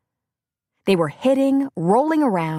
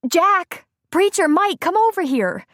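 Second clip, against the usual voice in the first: calling out; 12 semitones higher, 4 dB louder than usual. A narrator's reading. Calling the men over.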